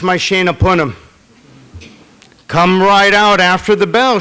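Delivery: loud, fussing mother piling on endearments; a mother who can't let go at the school gate; loud doting mom calling after her son. Acted. Mother fussing at kindergarten drop-off.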